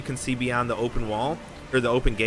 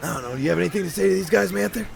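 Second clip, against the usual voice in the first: NPC Jaws voice; faster than usual. Acted. GM voicing Jaws.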